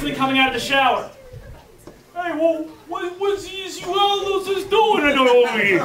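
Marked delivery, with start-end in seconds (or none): 2.1-5.8 lightly put-on, higher-pitched jock voice; a hint of a dopey locker-room player; goofy jock challenge. Player coming out of the shower questions intruders, goofy challenge.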